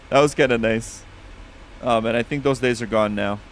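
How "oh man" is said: ordinary talk, nothing marked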